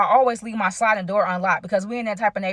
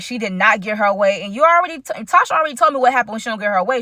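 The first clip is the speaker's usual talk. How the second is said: ordinary talk, nothing marked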